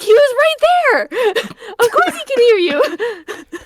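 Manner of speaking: high-pitched, drawn-out incredulous retort; exasperated. Retold or imitated. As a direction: retell it in laughing exasperation. Retelling an exasperated reply to friend.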